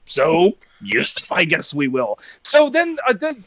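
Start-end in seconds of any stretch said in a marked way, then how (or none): 0.0-2.2 very low, resigned little-creature impression; low comic impression, tailing off softer; Yoda. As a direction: croak it low in inverted-syntax pauses. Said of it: "I'm gonna quote my brother, and he must have said".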